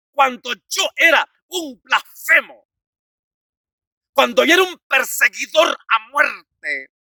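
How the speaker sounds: loud, high, declaimed testimony; full-force preaching. Acted. (predicación a plena voz, declamada)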